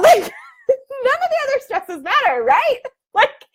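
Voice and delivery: laughing. Real, not acted. Laughing after the triumphant cheer.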